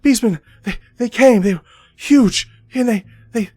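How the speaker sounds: put-on high, loud, stammering terrified boy; hysterical boy. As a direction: blurt it out in panic, tripping over the words. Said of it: "he's kind of hysterical".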